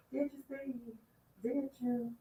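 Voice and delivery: hushed, doubting; skeptical hushed question. Real, not acted. Host quietly doubting Steve's story.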